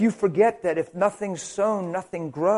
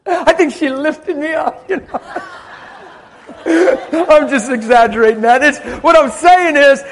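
loud, high, laughing through the words; real laughter; 8 semitones higher, 11 dB louder than usual. Real, not acted. Preacher joking about his wife lifting him, laughing loudly. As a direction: crack up at your own exaggeration.

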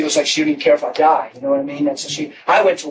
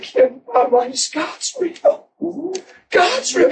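ecstatic, rising; 11 semitones higher, slower than usual. Retold or imitated. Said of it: "I said".

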